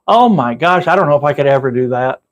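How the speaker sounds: ordinary delivery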